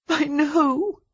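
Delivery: high, tearful sob; sobbing. A narrator's reading. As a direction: sob the words out. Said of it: "she sobbed".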